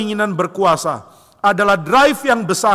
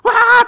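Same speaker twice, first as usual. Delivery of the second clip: high, loud screamed wail; hammed-up child shriek; louder mock scream; 9 dB louder than usual. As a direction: imitate a child screaming even harder. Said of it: "teriak lebih keras".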